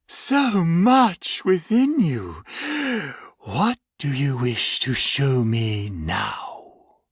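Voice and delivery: slow, lightly voiced monster savoring its words; a hint of a sinister creature reminiscing; menacing creature. Creature probing its captive.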